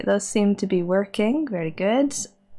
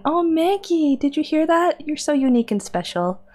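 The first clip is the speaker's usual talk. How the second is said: pet talk; 8 semitones higher than usual. Real, not acted. Talking to her pet.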